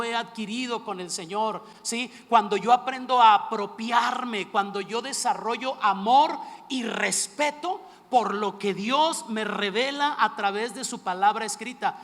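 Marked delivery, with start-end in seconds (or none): 2.3-12.0 predicación enérgica. Acted. Predica con fuerza.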